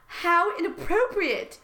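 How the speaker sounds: slow, high-pitched indignation; mock scandalized. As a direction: draw it out, high and affronted. Voices scandalized interviewer.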